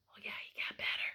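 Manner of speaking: whispered aside to the class. Real, not acted. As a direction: lean in and whisper it.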